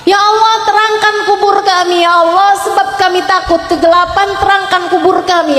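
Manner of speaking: urgent prayer. Retold or imitated. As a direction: call out a fervent, imitated supplication. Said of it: "begitu beliau langsung doa".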